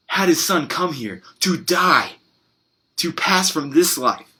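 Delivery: fervent preacher building to emphatic key phrases; preaching. Real, not acted. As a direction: press the congregation with rhetorical questions. Preaches an appeal with rhetorical questions.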